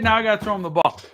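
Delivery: normal talking voice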